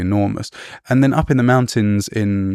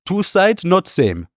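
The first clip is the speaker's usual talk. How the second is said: put-on slow, higher-pitched crude Indian accent; 10 semitones higher, slower than usual. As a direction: imitate simple English in an Indian accent. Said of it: "I apologise for that crude accent".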